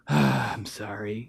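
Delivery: big sigh, then low, slow, soft sorry; insincere grudging apology. Retold or imitated. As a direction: drag it out like a kid forced to apologise. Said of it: "you gotta say it like you mean it".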